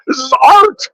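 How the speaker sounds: high, hammed-up cry of This is art; mock dramatic cry. Showing off his quote to the cohost with a hammed-up cry. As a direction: overplay it like a tortured artist.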